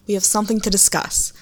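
normal talking voice

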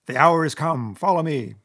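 loud, commanding call to comrades; rallying cry. A narrator's reading. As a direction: cry out to lead the charge. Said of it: "glanced round at his comrades and cried".

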